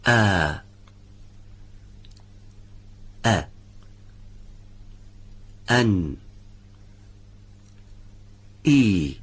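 isolated Arabic hamza syllables, each cleanly separated; hamza pronunciation demo. Shown as a demonstration. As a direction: sound out ا، ای، او from the throat with a sudden glottal release.

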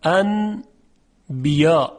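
انبیا chanted slowly, n turned to a held nasal m; Quranic recitation. Shown as a demonstration. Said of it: "تلفظ صحیح هون اینطوریه".

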